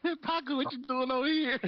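laughing mock greeting; high mocking call. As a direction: sing it out high to tease Paco.

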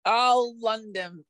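slow, high, badly faked British accent; failed British accent. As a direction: drag out a bad British accent. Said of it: "Why can't you do a British accent".

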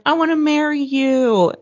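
imitating an excited boyfriend; super excited. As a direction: gush like an eager suitor. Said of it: "he was super excited".

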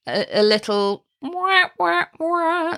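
silly rising wah wah, sung high; a goofy jingle of wahs, pitch jumping up; cartoon wah wah sound effect; playful wah wah. Shown as a demonstration. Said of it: "little fun break sound".

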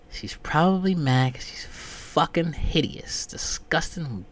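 normal talking voice

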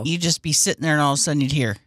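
usual speaking voice

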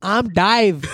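mock Australian accent. Friend echoing the Australian corporal's line, mock Australian accent. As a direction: say it like a casual Aussie soldier. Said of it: "the Australian was like".

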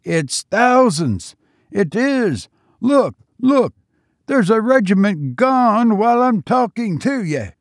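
high excited shouting in a Cockney soldier's manner; can't believe his eyes, voice up high; thrilled Tommy shouting at the falling enemy; amazed soldier. A narrator's reading.

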